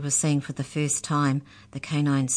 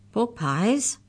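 higher, uncertain echo; stammered question; 6 semitones higher than usual. A narrator's reading. Mallory questions her accusers, nervously puzzled. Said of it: "stammered Mallory".

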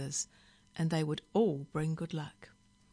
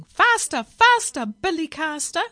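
frantic high, loud squawking; parrot scream; 11 semitones higher, 11 dB louder than usual. A narrator's reading. Parrot swinging on driver's earring, screaming for speed. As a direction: screech it as an urgent chant. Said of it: "it screamed in his ear".

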